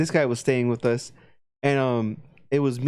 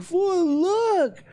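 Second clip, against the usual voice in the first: mock Ivan. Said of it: "Ivan's just standing there like".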